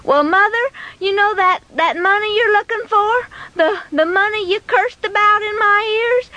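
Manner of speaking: put-on high, hesitant child's voice; nervous boy testing the waters; fearful boy. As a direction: confess haltingly to an angry mother. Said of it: "stood there in fear and trembling".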